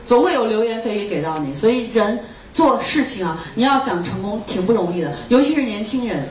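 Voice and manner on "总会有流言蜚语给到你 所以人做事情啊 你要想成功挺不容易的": ordinary delivery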